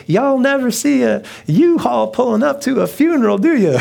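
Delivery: high, loud Southern woman impression; hammed-up Southern accent. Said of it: "she has a Southern accent".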